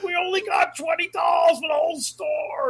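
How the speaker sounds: high frantic shout, imitating a manager; shrill-pitched imitation of a screaming man; mock screaming. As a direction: yell over a mob like a panicked manager. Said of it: "he's screaming".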